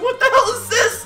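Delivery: high squeaky shocked outburst; stunned, laughing disbelief. Real, not acted. Stunned reaction to news.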